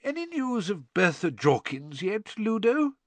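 lightly voiced concerned father; mild worried question; Mr Weasley voice. A narrator's reading.

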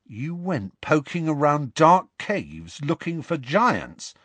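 put-on high, amazed boy's question; awed respect. A narrator's reading. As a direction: marvel at such daring. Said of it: "with awed respect in his voice".

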